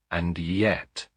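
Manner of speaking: usual speaking voice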